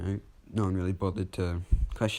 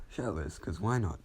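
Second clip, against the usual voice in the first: ordinary delivery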